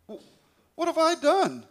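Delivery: stunned, wounded disbelief; indignant surprise. A narrator's reading. David answering his accusing brother, high indignant cry.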